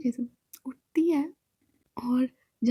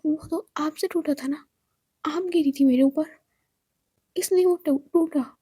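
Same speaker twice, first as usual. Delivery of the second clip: usual speaking voice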